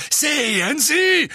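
loud, high thundering shout. A narrator's reading. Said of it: "donnerte Fudge".

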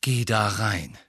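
low winded gasp; out of breath. A narrator's reading. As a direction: gasp it, chest aching. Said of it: "keuchte er".

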